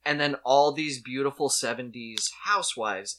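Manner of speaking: ordinary talk, nothing marked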